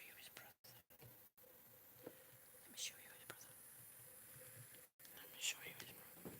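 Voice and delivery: soft whisper near a sleeping pet. Real, not acted. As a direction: whisper so the bird stays asleep.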